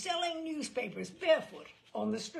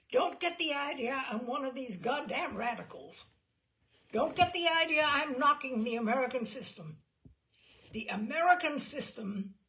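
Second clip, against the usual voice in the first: louder, higher gangster insisting, mildly angry; urgent protest; 4 semitones higher, 6 dB louder than usual. Retold or imitated. Gangster warning the sympathetic reporter off, louder and indignant. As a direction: push back hard against the reporter. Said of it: "an urgent, angry waggle of his podgy hand".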